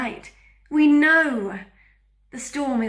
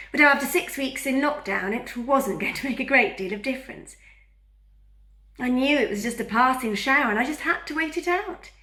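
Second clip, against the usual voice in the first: ordinary talk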